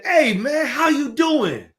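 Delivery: big, loud, slow friendly hello; hearty greeting. Shown as a demonstration. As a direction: call it out to catch someone off guard.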